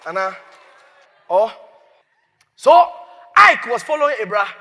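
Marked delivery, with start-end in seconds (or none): 2.6-4.5 shouted out to the congregation. Real, not acted. Preacher shouting to his congregation. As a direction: call it out big and loud.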